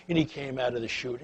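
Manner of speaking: ordinary talk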